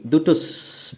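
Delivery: ordinary talk, nothing marked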